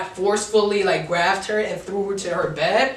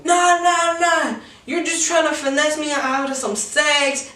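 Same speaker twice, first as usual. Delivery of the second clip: mock girl; 4 semitones higher than usual. Mocking girl's refusal.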